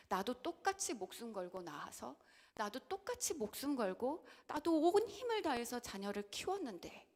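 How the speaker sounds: usual speaking voice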